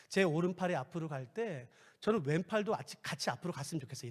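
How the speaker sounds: quiet, low wish; prayer request whispered softly. Shown as a demonstration. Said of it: "이렇게".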